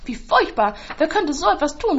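loud, rising feigned shock; mock horror. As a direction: fake dismay to hide her guilt. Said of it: "ruft Anne". (laut gespieltes Entsetzen)

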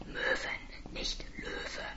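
tonloses Flüstern; flüstere die Korrektur; geflüstert. Acted. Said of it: "sage ich zu dir". (geflüsterte Korrektur)

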